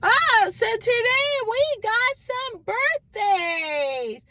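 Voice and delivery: high, slow party-announcer imitation. Mimicking the bar announcer to the crowd, high and slow. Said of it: "they made an announcement".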